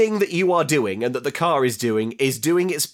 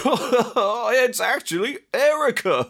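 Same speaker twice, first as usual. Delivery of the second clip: high, slow mock-giggling announcer; through laughter; 9 semitones higher, slower than usual. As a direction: imitate an announcer who can't stop laughing. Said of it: "through peals of laughter".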